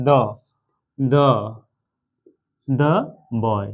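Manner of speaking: put-on "the boy" with THE as দ, no ee sound; careful, separated model of "the boy" with duh; THE before a consonant. Shown as a demonstration. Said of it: "এর উচ্চারণ কি হবে তাহলে".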